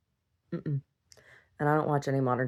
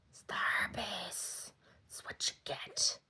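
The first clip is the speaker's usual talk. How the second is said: soft unvoiced whisper; 16 dB quieter, slower than usual. Real, not acted. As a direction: breathe it like a secret. Whispered joke about herpes.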